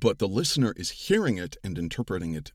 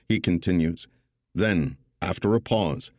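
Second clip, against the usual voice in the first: louder, measured storytelling read; audiobook narration; 4 dB louder than usual. Acted. Narrator reading his audiobook excerpt to the panel, louder measured narration. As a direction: narrate it for the listener, steady and deliberate.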